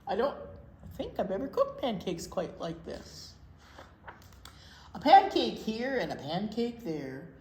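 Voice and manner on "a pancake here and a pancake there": bouncy sing-song storybook reading; reading to children. Acted. Librarian reading pancake rhymes to kids, sing-song. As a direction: read the rhyme aloud to little kids. Reads rhyming picture book aloud.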